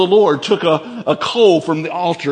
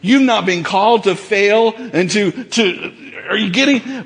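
ordinary talk, nothing marked

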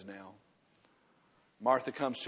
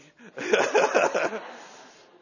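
high loud laughter; 10 semitones higher, 11 dB louder than usual. Real, not acted. Laughs at the cost.

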